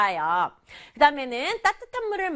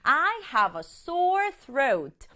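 slow model sentence; 3 semitones higher, much slower than usual. Shown as a demonstration. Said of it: "sore이라고 해요".

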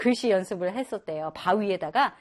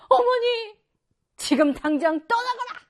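high, loud mock cry then order; mock pleading then command; 12 semitones higher, 5 dB louder than usual. Shown as a demonstration. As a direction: overplay the scene for laughs. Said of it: "이렇게 되는 거예요". (과장된 연기)

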